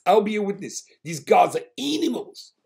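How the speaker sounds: outraged South African passenger ranting; South African accent. Retold or imitated. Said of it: "a South African guy".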